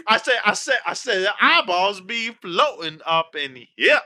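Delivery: high, loud cartoon Southern rooster; Foghorn Leghorn. Joining in on the Foghorn Leghorn bit. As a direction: laugh into an over-the-top Foghorn Leghorn. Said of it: "Foghorn Leghorn from Looney Tunes".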